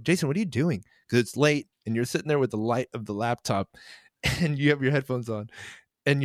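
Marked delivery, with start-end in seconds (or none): 0.0-0.8 milder, more casual challenge; slightly raised but restrained questioning; toned-down confrontation. Retold or imitated. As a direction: ask it with only mild irritation. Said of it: "I didn't say it like that".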